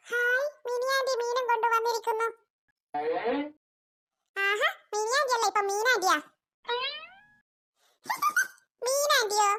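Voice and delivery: put-on high, bouncy child voice teasing the aunt; excited little kid.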